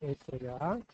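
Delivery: slow soft call to Jesus; prayer call. Acted. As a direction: open the prayer gently. Adult leads child in prayer. (మెల్లని ప్రార్థన పిలుపు)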